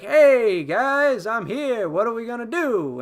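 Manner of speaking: high, loud, drawn-out greeting; hammed-up breezy entrance. Lando showing up again to the crew, mock exuberant. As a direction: sing out a showy arrival, play-acting. Lando's grand return acted out.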